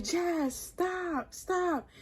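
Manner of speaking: high, hushed retold pleading; soft plea. Boyfriend asking her to stop punching, soft high plea. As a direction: imitate him softly begging her to stop.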